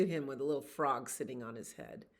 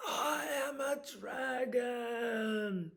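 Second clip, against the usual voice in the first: slow, higher furious dragon shout; angry dragon; 8 semitones higher, much slower than usual. Acted. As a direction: draw it out like a fire blast. Dragon breathes fire.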